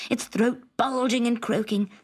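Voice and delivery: plain reading voice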